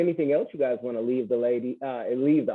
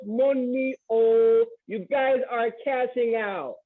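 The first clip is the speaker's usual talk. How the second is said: put-on higher-pitched West African accent; Nigerian accent; 7 semitones higher, slower than usual. Host telling nurses they earn well, in a Nigerian accent. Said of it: "in my Nigerian, West African voice".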